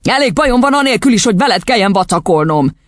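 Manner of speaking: harried scolding; exasperated father. A narrator's reading. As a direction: grumble impatiently at the child.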